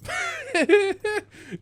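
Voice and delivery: sudden high-pitched burst of laughter. Real, not acted. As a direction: cracks up in a high squeal. Laughing at the obvious explanation.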